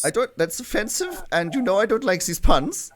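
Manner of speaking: high, loud protesting koala voice; indignant koala character. Acted. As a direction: protest theatrically in a high put-on accent. GM answers players as koala N.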